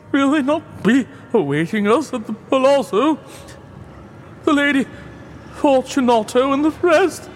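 a trapped man pretending it's a jest; Fortunato's sad voice. A narrator's reading. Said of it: "The voice said".

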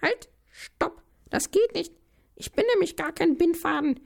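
high squeaky shriek; noodle shouting. Acted. Noodle yells at the gardener tying the sack, high and squeaky. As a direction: cry out to stop him. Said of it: "schreit die Nudel".

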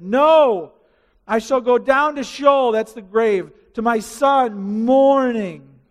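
lightly voiced weeping Jacob; mourning father. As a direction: stretch the last words in lament. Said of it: "his father wept".

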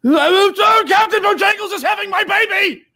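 loud, very high-pitched cry; high hysterical shout; waking outburst. Acted. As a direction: yelp it out as you wake in a panic.

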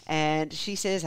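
ordinary delivery

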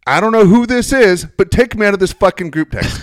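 high, loud snappy reply; mother snapping at her daughter, mock angry; mock angry mom. Mom's angry reply, retold.

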